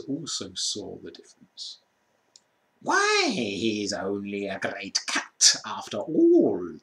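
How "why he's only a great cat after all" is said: slow, mocking creature voice; jeering creature. A narrator's reading. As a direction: sneer at the lion. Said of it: "cried one".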